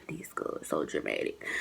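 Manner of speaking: hushed aside under the breath; whisper. Retold or imitated. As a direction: whisper it so she can't hear. Aside while roommate approaches.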